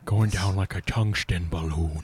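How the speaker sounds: slow, low drawl of the joke; drawn-out mock phrase. Co-host riffing on the tungsten balloon joke, slow and low. Sings out band-name style joke.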